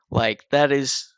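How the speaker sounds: talking through a yawn. Real, not acted. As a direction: yawn mid-sentence. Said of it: "Wow, I'm yawning a lot today".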